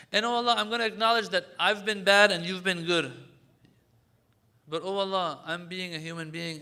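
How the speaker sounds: loud, earnest confession to God; fervent prayer of repentance; impassioned plea. Acted. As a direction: pour out the apology, raising the voice. Acting out a sincere plea to Allah.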